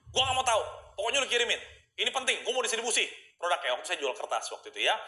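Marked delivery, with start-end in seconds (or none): 0.1-3.7 tiru bentakan pelanggan, nada lebih tinggi; customer galak. Retold or imitated. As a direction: membentak seperti bos yang tak mau tahu. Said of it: "customer saya galak yang minta ampun". (pelanggan galak menuntut barang dikirim hari ini)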